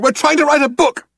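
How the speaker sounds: high, loud indignant protest; yelled. A narrator's reading. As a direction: yell your excuse desperately. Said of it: "yelled Ford".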